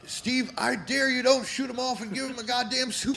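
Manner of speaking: high, loud hammed-up dare; loud playful taunt; mock taunting Jake. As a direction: goad him like a smirking heel. Acting Jake taunting Steve.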